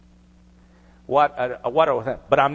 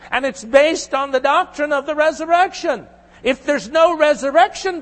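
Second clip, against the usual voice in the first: raised, higher-pitched preaching push; 7 semitones higher, 4 dB louder than usual. Acted. Pastor pressing the doctrine of resurrection on listeners, preaching hard. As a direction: drive the point home to the congregation.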